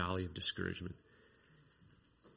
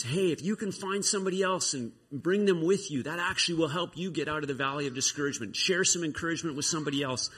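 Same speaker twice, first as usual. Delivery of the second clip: pitched-up calling down; mild mock yell; 6 semitones higher than usual. Encouragement yelling down into the valley, mild mock yell. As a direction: call urgent advice, starting high. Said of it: "Encouragement yelled at him is".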